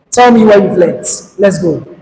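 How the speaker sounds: ordinary delivery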